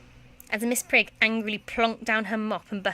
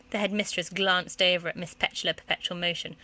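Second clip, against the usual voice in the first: ordinary delivery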